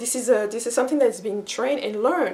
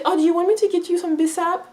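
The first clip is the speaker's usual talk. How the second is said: higher-pitched, sweet offer to help; eager little child; 6 semitones higher than usual. Retold or imitated. Toddler sisters offering to fetch drinks, happily eager. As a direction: imitate a toddler keen to serve, voice lifted. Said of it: "they will be happily doing this".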